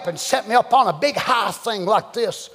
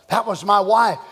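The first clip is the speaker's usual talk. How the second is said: usual speaking voice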